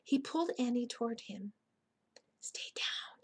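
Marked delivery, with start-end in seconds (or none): 2.4-3.2 soft, urgent whisper. A narrator's reading. Jack pulling Annie low near the dinosaur, whispering. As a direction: whisper it as a cautious order.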